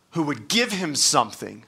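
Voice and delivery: normal talking voice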